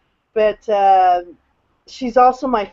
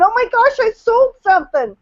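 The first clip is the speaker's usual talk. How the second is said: retold squeal of joy, pitched far higher; thrilled; 12 semitones higher than usual. Telling the panel about her first sale, thrilled. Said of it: "and I'm just going".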